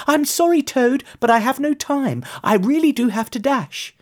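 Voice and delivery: high-pitched, hurried moth voice; small creature excusing itself in a high voice; polite little moth. A narrator's reading.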